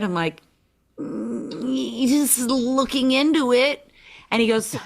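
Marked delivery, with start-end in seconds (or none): 1.0-3.9 drawn-out, higher, noncommittal reply; coy hedging. Retold or imitated. Would-be playmate answering the head editor by the elevator, coy and hedging. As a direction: stretch it out as if playing it casual.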